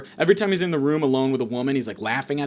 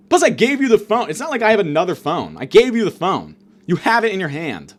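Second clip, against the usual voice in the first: rising, louder exasperated rant; 4 semitones higher, 4 dB louder than usual. Real, not acted. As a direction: tear into him, shouting in disbelief. Ranting at homeless man.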